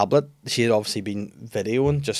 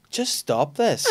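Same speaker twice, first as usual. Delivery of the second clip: mocking child; 6 semitones higher, much slower than usual. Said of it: "she goes".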